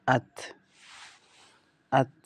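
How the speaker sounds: আত জজম দিয়ে মিলিয়ে পড়া; আত, ত থেমে বন্ধ উচ্চারণ; Arabic letter reading. Shown as a demonstration. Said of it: "একসাথে মিলিয়ে পড়লে". (আত পড়ুন হামজা আর সাকিন তা একসাথে)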